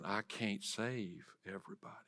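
low-volume, breath-only confession; hushed realization. Retold or imitated. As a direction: imitate someone quietly admitting it to herself. Voicing wife's quiet realization.

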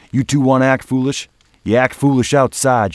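high, loud stern order; commanding. Acted. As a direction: lay down the law loudly. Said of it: "she commanded".

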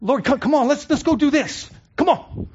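rising, eager pushing; impatient urging. Pretend. Believer urging the Lord to go, impatient and eager. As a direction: hurry God along like a restless child. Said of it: "getting out in front of the Lord".

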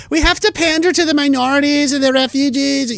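high mock-pleading politician voice; put-on high, sanctimonious voice. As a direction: whine it high, sending up a pandering politician. Mimicking politicians he mocks.

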